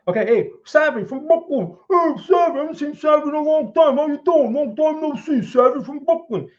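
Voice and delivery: big cartoonish Brooklyn accent, high and loud; old Brooklyn accent. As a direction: greet Savvy like an old-time Brooklyn guy. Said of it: "He probably doesn't talk like that".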